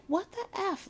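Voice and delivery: high, hushed muttered shock; small, stunned whisper-like aside; under her breath. Retold or imitated. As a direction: mutter it softly to yourself. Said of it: "Like, under her breath".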